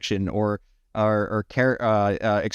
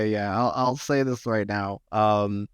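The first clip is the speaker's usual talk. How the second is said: ordinary delivery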